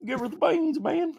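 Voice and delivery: high mock-tearful Southern impression; Camelot. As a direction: say it way up high, almost weepy. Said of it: "as I'd say the voice".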